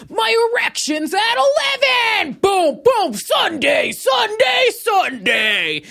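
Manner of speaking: booming high announcer shout, slow; monster truck ad. Pretend. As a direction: yell it like a monster truck rally ad. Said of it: "like a monster truck rally to be like".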